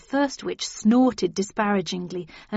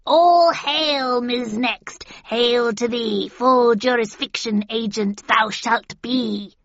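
witch hailing; 3 semitones higher, 5 dB louder, slower than usual. A narrator's reading. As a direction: proclaim it like a witch. Witches hail her.

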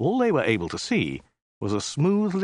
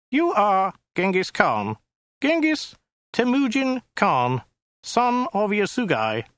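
high, slow, weaselly alien voice; small weaselly voice; 10 semitones higher, slower than usual. Acted. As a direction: read out his name slowly like a petty clerk. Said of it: "in a small, weaselly voice".

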